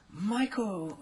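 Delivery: put-on high girlish voice calling his name; teen girl voice. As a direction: pipe up like a shy teen fan. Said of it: "and she said".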